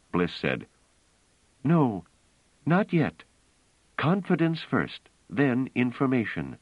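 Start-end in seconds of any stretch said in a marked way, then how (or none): 1.6-6.6 much higher, insistent story voice; high, emphatic refusal; firm woman character. A narrator's reading. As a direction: overrule him firmly in a raised pitch. Bliss firmly overrules Trevise.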